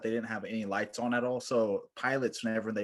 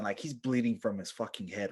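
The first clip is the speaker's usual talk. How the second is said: ordinary talk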